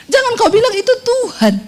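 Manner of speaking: normal talking voice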